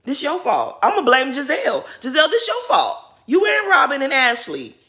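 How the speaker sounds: loud blame. Real, not acted. Vlogger blaming Giselle, loud and high. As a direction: call Giselle out hard.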